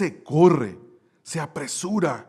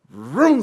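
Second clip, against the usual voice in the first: a short, loud "rum" of a car swerving past; imitated engine roar; 5 semitones higher, 6 dB louder than usual. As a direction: make the car noise as it cuts in. Car cutting in on him. (imitación del motor, breve y fuerte)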